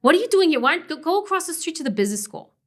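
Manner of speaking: put-on a fast Brooklyn-accented outburst of disbelief; loud incredulous Brooklyn accent. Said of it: "She's got this delightful accent".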